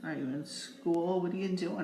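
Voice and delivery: ordinary delivery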